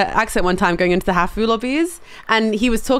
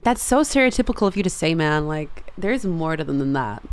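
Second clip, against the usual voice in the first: put-on Cali accent; 4 semitones lower, 5 dB quieter than usual. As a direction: play an affronted Cali bro accent. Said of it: "traditional Cali accent".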